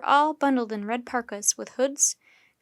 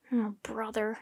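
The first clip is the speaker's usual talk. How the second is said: inner thought; 6 dB quieter than usual. A narrator's reading. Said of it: "thought Jack".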